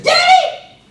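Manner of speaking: put-on high, loud little-girl voice, dragged out slowly; loud, high child blurting out an embarrassing question; little daughter shouting. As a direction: shout it across the store like a delighted toddler. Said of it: "my daughter looks up at me and says".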